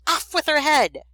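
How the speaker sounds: loud, high-pitched royal command; yelling queen. A narrator's reading. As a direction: yell it like a storybook queen. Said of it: "always yelling".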